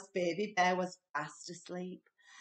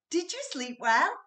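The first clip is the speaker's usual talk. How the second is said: high, louder bright greeting; a chirpy wife waking her husband; cheerful Missus Bear; 8 semitones higher, 6 dB louder than usual. A narrator's reading. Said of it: "she said".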